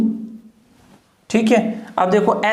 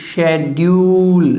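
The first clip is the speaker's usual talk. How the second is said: Schedule drawn out slowly, syllable by syllable; slow demo; 3 semitones lower, much slower than usual. Shown as a demonstration. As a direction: spell out schedule slowly for the class. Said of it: "pronunciation इसका क्या है?". (Schedule धीरे धीरे, साफ़ साफ़)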